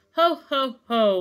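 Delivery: hearty spoken ho-ho-ho, high start; Santa laugh. A narrator's reading. Santa racing through the sky, hearty spoken laugh. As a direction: laugh in words like jolly Santa. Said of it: "laughed Santa".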